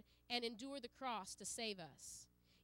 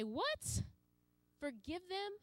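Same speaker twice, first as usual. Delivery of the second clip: higher, rising disbelief on "Forgive them?"; incredulous; 6 semitones higher, slower than usual. Pretend. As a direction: sound shocked at the idea. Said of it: "And sometimes I say".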